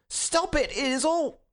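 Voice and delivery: high, desperate yell, cut short; frantic cry. A narrator's reading. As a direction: frantically try to halt them. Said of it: "cried Gregory with frantic face and hands".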